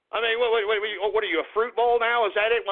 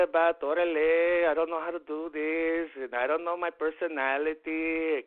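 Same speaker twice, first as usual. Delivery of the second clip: put-on held Chicano street accent, slang-heavy; 4 semitones lower, 4 dB quieter than usual. Ranting at his listeners about personality, Chicano accent. Keeps the accent, vato, Holmes.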